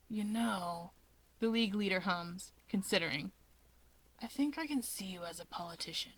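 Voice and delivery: soft unvoiced whisper, curious; whispery Shigaraki. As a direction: breathe the question quietly. Shigaraki humming, considering.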